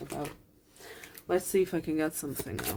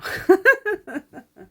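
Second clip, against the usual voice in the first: tearful laugh; 10 dB louder than usual. Real, not acted. Laughs through tears after admitting crying.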